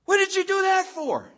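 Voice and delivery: high, loud scolding yell; frustrated parent shouting at the kid who fell. Retold or imitated. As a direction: snap at the fallen child. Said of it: "and you go".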